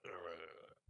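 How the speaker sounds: low, soft annoyed groan. Retold or imitated. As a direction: grunt at the interruption. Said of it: "I was like".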